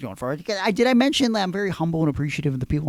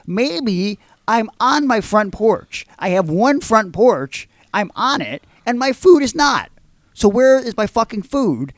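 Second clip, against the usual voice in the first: higher, louder, slow exasperated outburst; retold furious rant; 6 semitones higher, 6 dB louder, slower than usual. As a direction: explode at the clueless driver. Driver repeats, he blows up.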